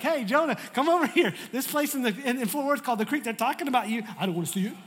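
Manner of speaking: high, loud playful call; God calling. Retold or imitated. God calling Jonah over to hear the sermon, high playful. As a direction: beckon him over, teasing. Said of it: "God's like".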